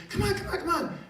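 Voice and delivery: put-on very high, insistent child voice; imitating a small kid's eager pleading; excited six-year-old. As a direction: tug Dad along impatiently. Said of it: "his six-year-old son came running in".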